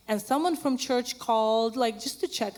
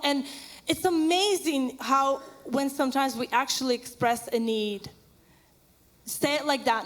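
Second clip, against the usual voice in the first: usual speaking voice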